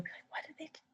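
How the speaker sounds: whispered aside to students. Shown as a demonstration. Teacher whispering a confused listener's reply. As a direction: drop to a whisper for the mock question. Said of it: "whispered aside".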